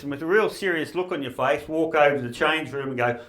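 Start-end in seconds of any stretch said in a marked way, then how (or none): none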